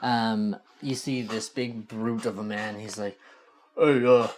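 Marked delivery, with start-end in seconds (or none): 3.8-4.3 loud, dopey guard shouting awake; dopey cartoon bellow. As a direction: bellow like a dim-witted guard. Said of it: "You don't have to be so loud".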